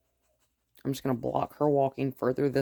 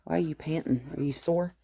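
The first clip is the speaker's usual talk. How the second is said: ordinary talk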